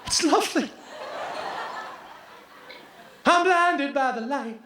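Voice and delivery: dazzled rabbit. Acted. The rabbit in the headlights, lightly voiced and dreamy. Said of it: "in his little rabbit head, he thinks".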